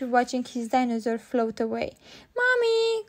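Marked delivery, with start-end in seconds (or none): 2.4-3.0 put-on high, little-girl call; crying out. A narrator's reading. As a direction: call out for mum in a high child's voice. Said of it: "cried Peppa".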